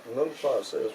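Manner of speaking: ordinary delivery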